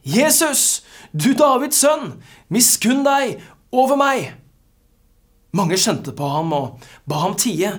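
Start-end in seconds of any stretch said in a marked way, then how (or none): 0.0-4.3 high, loud cry for mercy; calling out. Acted. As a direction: call out to Jesus over a crowd, pitched well up. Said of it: "satte han i å rope". (rope høyt og bedende)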